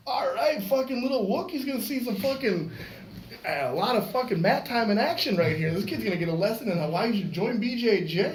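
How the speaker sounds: retold fight hype. Fireman getting up to fight the dad, hyped. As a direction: pump yourself up for a scrap.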